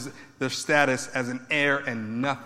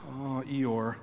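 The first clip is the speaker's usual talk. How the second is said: mopey Eeyore; 3 semitones lower, 9 dB quieter than usual. Retold or imitated. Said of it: "This isn't a".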